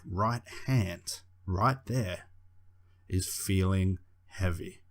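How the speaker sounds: slow, drawn-out trance voice; hypnotic voice. Shown as a demonstration. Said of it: "The second version is more of a hypnotic tone".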